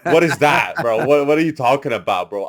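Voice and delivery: loud, exasperated disbelief; incredulous outburst. Retold or imitated. Rapper baffled by producer's terms, loud disbelief. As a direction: half-shout like nobody explained anything. Retold confusion at studio jargon.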